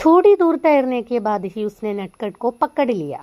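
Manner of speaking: slow, deliberate read-aloud; teacher reading a story to students. Acted. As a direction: read each word out carefully for the class. Reads Hindi story aloud.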